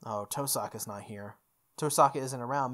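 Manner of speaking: ordinary talk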